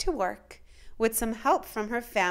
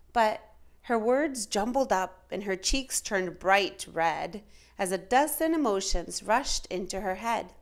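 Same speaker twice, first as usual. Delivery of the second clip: usual speaking voice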